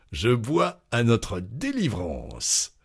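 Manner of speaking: slow, drawn-out proclamation; celebratory toast. A narrator's reading. Loiseau standing, champagne in hand, toasting grandly. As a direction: raise a glass and proclaim it grandly. Tipsy diner proposes a toast.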